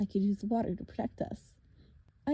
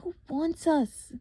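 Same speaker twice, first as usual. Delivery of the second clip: a higher, louder, slow demand; 9 semitones higher, 5 dB louder, slower than usual. Acted. Girl demanding answers from the monster, fierce. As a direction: insist on an answer. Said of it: "Bianca demanded".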